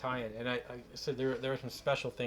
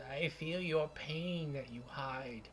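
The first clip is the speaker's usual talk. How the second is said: put-on old hag; 3 semitones higher, 4 dB quieter than usual. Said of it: "She says".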